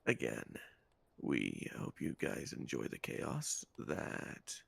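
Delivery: low, hushed ASMR whisper. Acted. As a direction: keep it hushed and calm. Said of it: "stop doing that A S M R voice".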